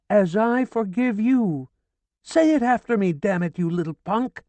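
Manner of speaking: high, loud frantic scolding; horrified shouting. A narrator's reading. Horrified widow shouting at Ferdinand to pray, frantic. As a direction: shout the prayer at him. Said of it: "She was horrified".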